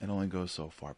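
usual speaking voice

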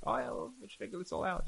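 high, breezy mock of a naive newlywed; naive couple. Rabbi mimicking naive couples before marriage, high and breezy.

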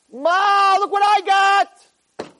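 loud, high, drawn-out triumphant yell; acted slow victory shout; imitated scream. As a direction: holler like a boxer showing mother the belt. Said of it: "why aren't you screaming to them".

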